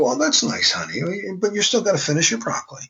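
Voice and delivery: mild mock parent tone; breezy parent. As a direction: brush off the child kindly. Parent answering child, story.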